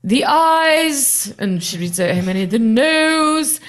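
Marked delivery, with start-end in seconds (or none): 0.0-1.3 "The ayes" called high and loud, drawn out; exaggerated speaker announcement. Deputy speaker reading out the vote result, hammed-up. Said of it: "in such a kind of exaggerated way". 2.5-3.6 "The ayes" called high and loud, drawn out; exaggerated speaker announcement. Deputy speaker reading out the vote result, hammed-up. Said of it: "in such a kind of exaggerated way".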